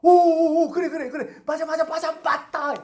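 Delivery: loud high whoop into rapid-fire agreement; eager chatter as if it all clicks; excited agreement. Acted. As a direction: act out delighted, high-pitched agreeing. Acting out people in eager agreement.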